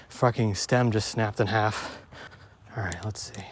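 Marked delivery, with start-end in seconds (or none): 2.8-3.4 a breathy whisper to himself. Real, not acted. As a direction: whisper it under your breath. Checking the bike after a scare.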